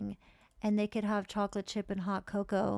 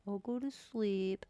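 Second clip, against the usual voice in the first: put-on small childlike voice, slowing at the end; a kid agreeing to bed; sleepy little kid; slower than usual. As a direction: obedient child answering mom. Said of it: "And he's like".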